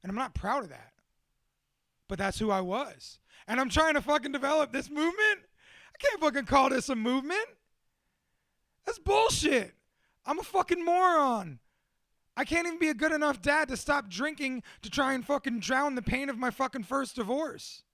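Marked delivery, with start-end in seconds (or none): 3.5-7.5 angry self-berating. Real, not acted. Raging at himself over drinking and failure, angry. 8.8-9.8 much higher, louder, spat-out bullshit; angry outburst. Real, not acted. Cursing his own failed movement, angry outburst. Angry self-berating rant. 10.2-17.8 angry self-berating. Real, not acted. Raging at himself over drinking and failure, angry.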